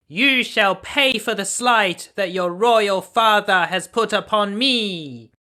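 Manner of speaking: high, loud, sneering fairy voice; mocking wicked fairy. A narrator's reading. The angry thirteenth fairy mocks the princess, high and sneering. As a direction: gloat over the cursed baby like a wicked fairy. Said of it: "she laughed and exclaimed".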